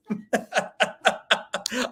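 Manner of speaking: light amused laugh. Real, not acted. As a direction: laugh at your own joke. Jokes about credit card.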